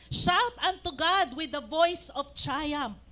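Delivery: loud, slow, declaiming Shout; proclaimed scripture. Shown as a demonstration. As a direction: proclaim it like a battle cry. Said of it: "Yan ang sabi ng psalmist". (malakas na pagpapahayag)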